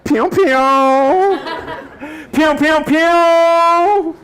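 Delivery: high, loud cartoon "pew" sounds, last one stretched; playful shooting sound effect; mock gunfire noise. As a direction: imitate toy laser shots, loud and high. Mimics gunfire at serviceman.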